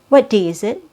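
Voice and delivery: normal talking voice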